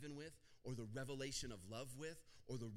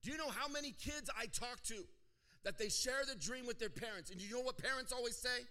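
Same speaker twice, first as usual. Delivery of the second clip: high, loud preacher's cry; fervent preaching; 12 semitones higher, 7 dB louder than usual. Real, not acted. Pastor challenging the crowd about parents, fervent preaching. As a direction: call it out to the whole room with force.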